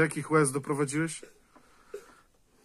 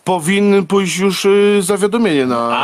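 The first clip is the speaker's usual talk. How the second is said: loud, higher, slowed insistence; 5 semitones higher, 12 dB louder than usual. Real, not acted. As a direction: hammer the point home. Heated argument. (głośne, wolne naleganie)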